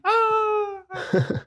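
very high, held mock scream; imitated scream. Showing cohost how Chon comes out yelling, mock high scream. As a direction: squeal out a long, high "ah" without extra volume. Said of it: "sale Chon gritando así".

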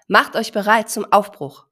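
loud herd leader's command; loud announcement. Acted. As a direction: call the herd to set off. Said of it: "verkündete seine Mama laut".

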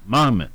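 Mamet said MAM-et, first syllable hit; first-syllable stress. Shown as a demonstration. Host repeating the name for listeners, MAM-et stressed on the first syllable. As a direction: land the weight on the front of Mamet. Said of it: "The emphasis is on the first syllable".

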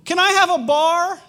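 put-on very high, loud child's call, slow on the question; yelling from upstairs. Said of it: "she yells from upstairs".

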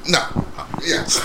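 usual speaking voice

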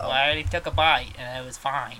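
put-on loud, shaky-footed Australian accent; attempted Australian accent. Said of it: "I can't do an Australian accent".

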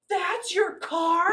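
high, loud, drawn-out disbelief; retold shriek of disbelief; incredulous outburst. Retold shocked reaction to the car.

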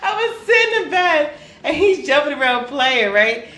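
high-pitched, barely holding back laughter; laughing while talking. Real, not acted. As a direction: tell it through real giggles. Cracking up while telling story.

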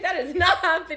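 insist, cracking up; laughing defiant refusal. Retold or imitated. Quoting own refusal, laughing.

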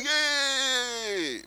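high, long drawn-out yay; put-on cheer, pitched high; mock cheer. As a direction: imitate a crowd's cheer, stretched out. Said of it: "people go like".

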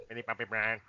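low, soft mumbled name; teasing impression of a besotted friend; mock friend's answer. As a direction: drop low and quiet for the punchline.

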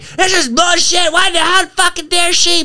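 retold angry outburst at the wife; angry yell. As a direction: rant it like a woken bear. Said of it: "I'm yelling".

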